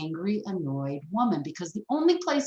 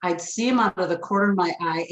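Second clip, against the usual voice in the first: ordinary talk, nothing marked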